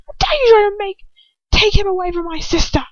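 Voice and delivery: high, loud raging witch; furious accusation. A narrator's reading. Bellatrix raging at the captive over her sister, furious. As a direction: rage at her for the betrayal.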